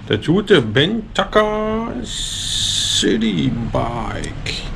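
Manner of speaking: very slow, hugely drawn-out product reveal; mock grand presentation. Presenting the old bike to viewers, grandly drawn out. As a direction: stretch the bike's name out like a showpiece.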